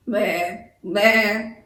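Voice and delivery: mild imitated bleating; a light goat bleat for the class. Shown as a demonstration. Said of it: "goats like to bleat".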